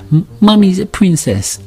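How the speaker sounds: put-on high little-girl voice; admiring toddler. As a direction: compliment Mummy like a small child. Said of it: "my daughter goes".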